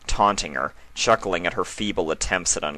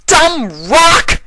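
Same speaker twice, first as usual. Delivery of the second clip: much higher, much louder furious yell; angry outburst; 12 dB louder than usual. Acted. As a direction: burst out screaming at the rock. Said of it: "Strada burst".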